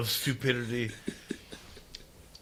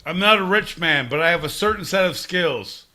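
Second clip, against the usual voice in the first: louder, declaiming the famous line; loud movie-line delivery; 7 dB louder than usual. Acted. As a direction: boom it like a big speech. Taken line delivered big.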